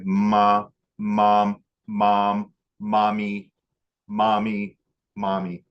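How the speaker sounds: imitating a droned "ma... mom... mommy"; long, level syllables with pauses between; sustained repeated call. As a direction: hold each word evenly, growing into "mommy". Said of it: "starts singing again".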